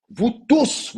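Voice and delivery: high, loud call to the crowd; indignant shout at the crowd. Real, not acted. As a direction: call them all out sharply. Scolding parents who clash online.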